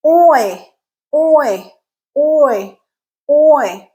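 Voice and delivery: oi gliding from open aw up to ee; oi diphthong demo. Shown as a demonstration. Teacher modelling the oi glide in class. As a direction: slide the tongue from aw to ee on each oi. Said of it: "you're going from ah to I".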